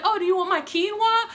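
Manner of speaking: high-pitched imitation of a friend offering; mimicking friend. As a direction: play the friend sweetly offering. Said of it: "she's like".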